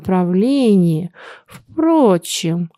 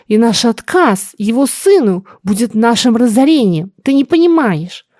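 higher, insistent mother; agitated scolding; 4 semitones higher, 3 dB louder than usual. Acted. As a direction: hammer home the danger. (взволнованно, настойчиво, выше)

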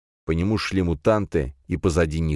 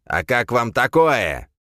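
gloating villain; 5 semitones higher, 3 dB louder, slower than usual. Acted. Villain gloats before attacking.

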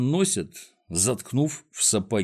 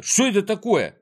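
high-pitched indignant protest; Незнайка, indignant; 12 semitones higher than usual. A narrator's reading. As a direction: protest in outrage, voice climbing high. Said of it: "возмутился Незнайка". (возмущённо, высоким голосом)

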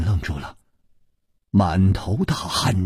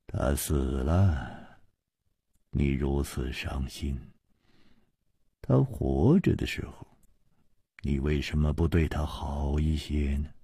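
sighing; 12 semitones lower, 10 dB quieter than usual. A narrator's reading. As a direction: sigh it out softly. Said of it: "楚留香叹道".

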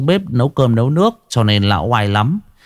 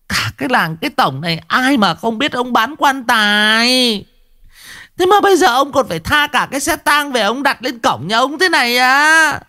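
much higher, indignant scold; furious scolding. Acted. Wife scolding her husband about the hearse, high angry scold. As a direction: berate him, glaring, pointing. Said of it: "bà trợn mắt chỉ mặt lão Đồng".

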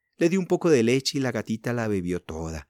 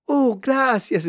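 put-on high, drawn-out girlish thanks; thrilled child, pitched way up; delighted exclamation; 14 semitones higher, 5 dB louder, much slower than usual. A narrator's reading. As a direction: gush slowly with delight. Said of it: "exclamó Elsie encantada".

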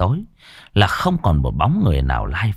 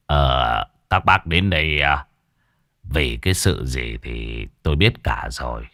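low, measured voice of a knowing elder; village master; 6 semitones lower than usual. A narrator's reading. Master Trung telling village elders what must be done, low knowing voice.

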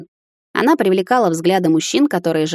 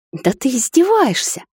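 higher, slow, stunned gasp; a daughter aghast at her mother's plan; gasping disbelief; 7 semitones higher than usual. A narrator's reading. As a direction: gasp it out as if she can't believe it. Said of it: "ахнула я".

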